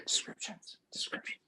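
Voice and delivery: eerie whispered echo. Pretend. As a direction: breathe the word like a ghost.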